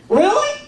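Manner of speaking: "Really" swung sharply up high as a question; doubting question. Shown as a demonstration. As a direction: ask "Really" in doubt, pitch climbing high. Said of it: "listen for the emphasis".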